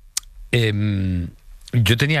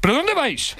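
very high-pitched incredulous cry; alarmed protest. Retold or imitated. Man to dogs climbing on him, high alarmed protest. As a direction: protest in a squeaky, startled pitch.

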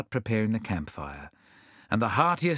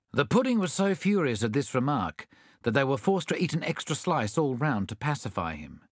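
plain reading voice

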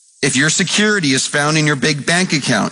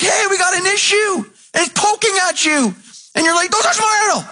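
high, shouted pulpit preaching; fiery preacher at full volume; full-force preaching. Acted. Preaching builds again.

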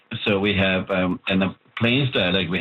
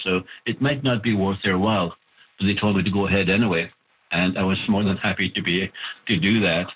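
ordinary talk, nothing marked

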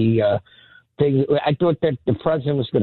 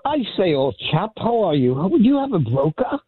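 higher-pitched put-on British accent; mock British accent; 7 semitones higher than usual. Caller greeting the radio host, playful fake British accent. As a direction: greet him like an English chap, hammed-up. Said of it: "I figured I'd do a little accent".

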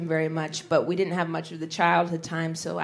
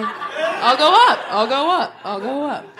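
high sing-song retreating dad; awkward basement dad; 7 semitones higher, 9 dB louder, slower than usual. Pretend. Dad excusing himself from the sleepover, awkward high sing-song. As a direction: sing it out higher, backing away. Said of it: "He'd totally be a basement dad".

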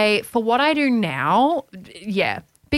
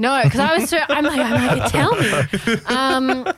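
laughing; 4 semitones higher than usual. Real, not acted. Laughing, caught off guard.

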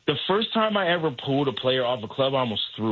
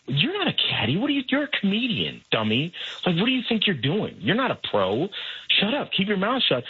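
higher, needling inner voice scolding him; devil on shoulder; 6 semitones higher than usual. Retold or imitated. Devil on caddy's shoulder berating him, scolding. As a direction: snap at him like a mocking little devil. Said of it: "that little devil on my shoulder who's, like, whispering in my ear".

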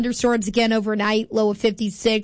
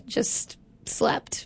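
breathy whisper; 7 dB quieter than usual. Retold or imitated. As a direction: whisper it sheepishly. Retold sleepy answer.

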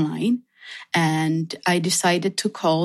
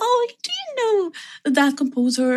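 high-pitched, keen asking; eager retold question; 9 semitones higher, faster than usual. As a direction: act out pestering colleagues, pitched high. Re-enacts asking her colleagues.

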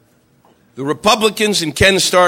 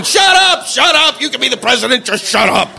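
exasperated shouting at the screen; mock yell; 5 semitones higher than usual. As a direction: yell it at the TV in frustration. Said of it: "It's going".

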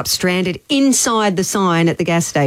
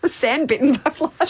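amused, high laughing repeat; 8 semitones higher than usual. Real, not acted. Host teasing co-host over a muddled phrase, laughing. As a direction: echo it through giggles, higher pitched.

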